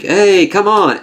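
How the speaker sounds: high, drawn-out cajoling call; coaxing offer. Retold or imitated. Fury offering bourbon to Rhodes, coaxing. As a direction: imitate a chummy nudge to join in.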